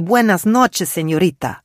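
cheerful waiter welcoming a guest; Spanish waiter greeting. A narrator's reading.

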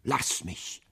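whispered, voiceless plea through a sob; dying wife, whispering her plea; sobbing whisper. A narrator's reading. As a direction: beg to be left, breath only. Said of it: "schluchzte Catherine".

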